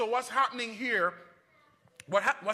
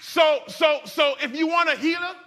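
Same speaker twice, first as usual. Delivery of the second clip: loud, high, building preacher's cry; preaching at full force; 7 semitones higher, 7 dB louder than usual. Acted. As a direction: preach it, lifting the congregation.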